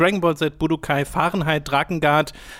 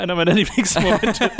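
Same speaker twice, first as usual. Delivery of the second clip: usual speaking voice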